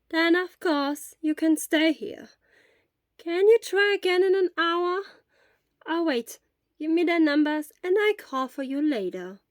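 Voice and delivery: put-on an old school nurse arranging things; Recovery Girl. A narrator's reading.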